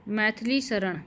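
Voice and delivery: usual speaking voice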